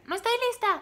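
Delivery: high, loud yell back; shouted reply. Retold or imitated. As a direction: imitate her yelling back from the house. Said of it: "y me grita".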